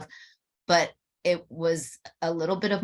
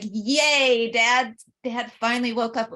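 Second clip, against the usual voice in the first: mock cheer; 8 semitones higher, 6 dB louder than usual. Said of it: "instead of, like".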